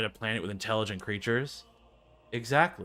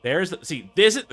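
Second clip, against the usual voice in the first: ordinary talk, nothing marked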